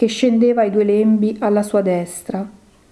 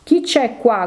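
ordinary delivery, nothing marked

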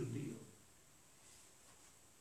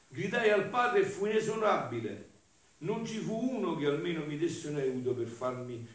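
raised, emphatic declamation; impassioned devotional reading; 4 semitones higher, 5 dB louder than usual. Acted. Preacher reads Jesus' agony before the Father, impassioned declamation. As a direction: declaim the anguish to the congregation.

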